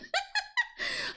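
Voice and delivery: very high-pitched laugh; high giggle. Real, not acted. Streamer apologising to a viewer for a forgotten command, high giggle. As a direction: let out a high, squealy giggle.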